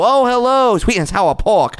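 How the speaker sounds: high, loud, deliberately bad Chinese accent; hammed-up Chinese-accent greeting. Shown as a demonstration. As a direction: overdo a clumsy Chinese accent. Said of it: "not good for me to even try it".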